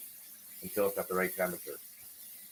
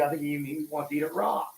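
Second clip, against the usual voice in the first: usual speaking voice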